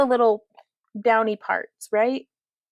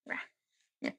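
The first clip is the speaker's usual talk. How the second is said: breathy little monster growl; whispered rawr; 15 dB quieter than usual. Pretend. Tiny monster noise, whispered.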